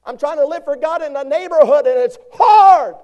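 high loud complaining voice; high loud mock lament; whiny excuse. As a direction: mimic a churchgoer whining. Preacher voices congregant's excuse.

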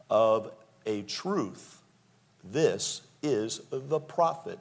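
slow and deliberate "Of a truth, this is the prophet."; firm, measured scripture reading; firm declaration. Retold or imitated. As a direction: say "Of a truth" slowly and firmly, with pauses. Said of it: "stood very firmly and they said".

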